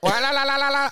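high mock hysterical cackle wa la la; imitated hysterical laugh. Imitating the barista's laugh, high mock cackle. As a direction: ape the barista's wild laugh. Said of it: "laughed hysterically in her Zambezi accent".

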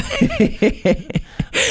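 high giggling laugh. Real, not acted. Guest laughs at her own casting joke, high giggle. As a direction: crack up in a high, rippling giggle. Cracks up after joking reply.

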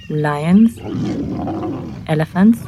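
plain reading voice

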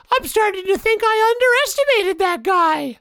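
high slow impression of the guy; Bronson voice. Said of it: "you do his voice so well".

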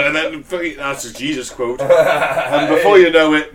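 ordinary talk

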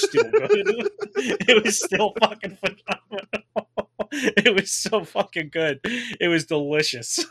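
high-pitched laughing through the words; giddy laughter, slowed and gushing. Real, not acted. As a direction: laugh while raving about the food. Laughing recalling the meal.

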